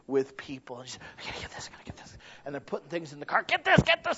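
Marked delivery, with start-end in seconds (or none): 1.2-2.2 hushed eager whisper. Retold or imitated. 3.4-4.2 high, loud mock frenzy of a grabbing shopper; frantic shopper.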